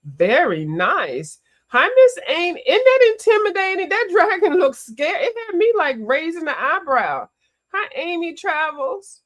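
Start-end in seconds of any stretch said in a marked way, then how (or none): 1.7-9.2 high, louder greeting to a viewer; light up at a friend in the chat; high, loud excited chatter. Real, not acted.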